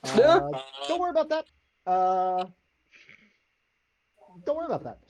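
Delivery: high, loud little voice, rushed; a tiny frantic voice inside your head; pixie. Acted. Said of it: "you hear a little voice in the back of your head".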